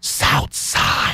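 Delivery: playful whisper. Pretend. Joking about secret art space.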